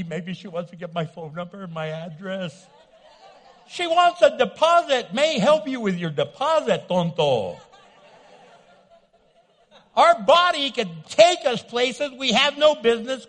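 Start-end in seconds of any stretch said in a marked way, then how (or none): 3.7-7.6 mock teller jab. Teller's real meaning, mocking.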